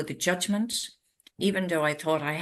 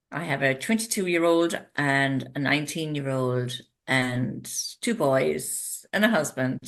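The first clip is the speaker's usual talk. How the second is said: usual speaking voice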